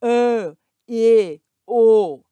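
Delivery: Ã, e, o as closed vowels, loud and held. Shown as a demonstration. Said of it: "ele é fechado".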